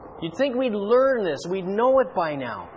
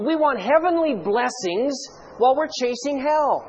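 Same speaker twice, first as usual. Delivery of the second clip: ordinary talk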